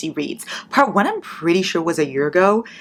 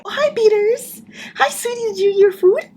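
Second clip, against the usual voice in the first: high sing-song pet voice. Real, not acted. Greeting her pet in a high baby voice.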